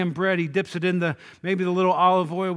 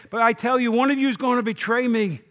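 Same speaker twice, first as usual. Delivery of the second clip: louder, higher accusation; angry indignation; 6 semitones higher, 6 dB louder than usual. Retold or imitated. Jesus confronting his friends at the table, angry and indignant. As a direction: burst out with indignation at the coming betrayal. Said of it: "he's angry that this is going to happen".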